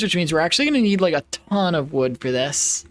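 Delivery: ordinary talk, nothing marked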